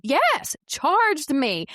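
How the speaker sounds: high, loud burst of disbelief at herself; excited insistence. Real, not acted. Insisting to her co-host the snake truly charged, excited and loud. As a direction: insist it really happened, pitch jumping up.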